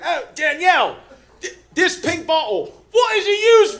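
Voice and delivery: high, loud call to a coworker far away; calling across the room. Retold or imitated. Agent calls coworker twenty feet away.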